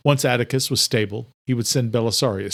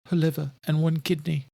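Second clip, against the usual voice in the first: higher, subdued servant; Adela's voice; 5 semitones higher than usual. A narrator's reading. Adela answers.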